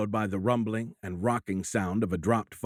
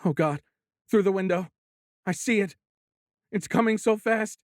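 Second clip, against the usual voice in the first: much higher and louder, climbing in panic; abject terror; 12 semitones higher, 4 dB louder than usual. A narrator's reading. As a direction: panic rising as she sees it come. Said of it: "The abject terror in the woman's voice".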